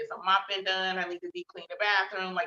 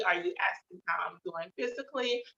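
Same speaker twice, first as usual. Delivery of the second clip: normal talking voice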